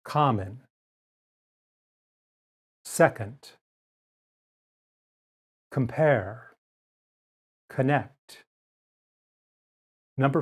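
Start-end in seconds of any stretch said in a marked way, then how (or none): 0.1-8.4 unstressed O in "Common" said as uh; careful word list; schwa demo words. Shown as a demonstration. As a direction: model each word slowly. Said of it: "pronounced as the sound uh".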